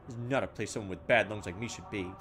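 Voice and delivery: normal talking voice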